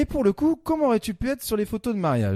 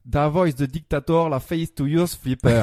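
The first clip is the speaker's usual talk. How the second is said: French lyrics read with a put-on English accent; best English accent; 2 semitones lower, 3 dB louder than usual. Shown as a demonstration. Columnist rereading French lyrics to find a clue, English accent. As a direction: mangle it into mock English pronunciation. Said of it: "avec mon meilleur accent anglais".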